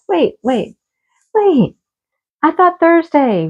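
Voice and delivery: objecting to the husband adding Friday, rising in disbelief; rising mock alarm. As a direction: stop him, building up in disbelief.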